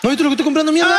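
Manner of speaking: high, indignant customer; hammed-up berating; mock anger. Friend joins the abusive-customer act.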